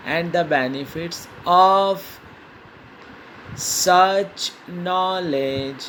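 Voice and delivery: very slow word-by-word dictation; dictating. Shown as a demonstration. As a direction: pause after each word for writing. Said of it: "dictation continues". (शब्द-शब्द रुककर लिखवाना)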